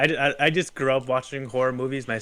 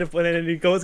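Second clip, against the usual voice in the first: ordinary delivery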